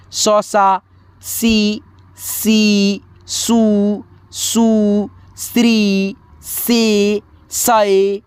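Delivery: slow teacher chanting each syllable apart; syllable chart recitation. Shown as a demonstration. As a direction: drill the barakhadi one syllable at a time.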